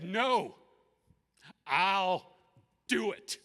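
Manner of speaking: high, very loud, word by word; resolute declaration. Retold or imitated. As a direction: proclaim the decision with full force. Jesus resolves to go through it.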